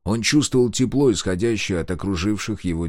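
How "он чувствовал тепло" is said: plain reading voice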